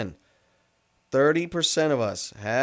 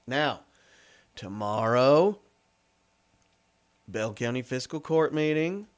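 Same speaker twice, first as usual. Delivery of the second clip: usual speaking voice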